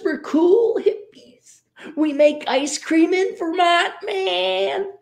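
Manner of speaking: high, laid-back, giggly stoner drawl; hippie voice. Retold or imitated. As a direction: ham up a lazy hippie. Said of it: "They're like".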